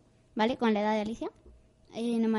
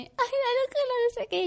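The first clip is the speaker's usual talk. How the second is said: very high mock whine; hammed-up high-pitched protest; complaining little sister; 11 semitones higher than usual. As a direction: imitate a fussing sister. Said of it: "se pone".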